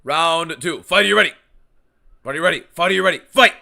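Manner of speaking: booming fighting game announcer. Pretend. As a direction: boom it out like a ring announcer. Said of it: "Love the Keith Peterson, uh, cadence there".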